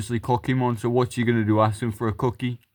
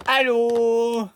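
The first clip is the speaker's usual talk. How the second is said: high put-on greeting; 10 semitones higher than usual. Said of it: "Be like".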